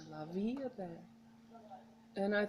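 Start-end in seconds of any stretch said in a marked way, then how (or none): 0.1-1.0 la vie est belle, softer and gliding in French; smooth soft French. Shown as a demonstration. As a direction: breathe it out like flowing water. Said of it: "It sounds smooth, like water".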